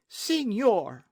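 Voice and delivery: high, loud exclamation; shocked protest. A narrator's reading. Señorita protests at the captain's demand, shocked. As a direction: cry out, affronted.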